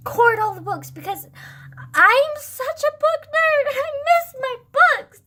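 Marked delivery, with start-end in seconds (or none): 1.9-5.2 high, slow, hammed-up whiny wail; mock whine. Vlogger pining for her books, high slow mock whine. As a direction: wail it like a melodramatic sob.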